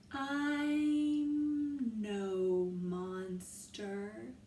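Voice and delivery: very slow, spaced words; stretched out. Shown as a demonstration. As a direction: draw out every word. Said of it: "spread those out and stretch them out".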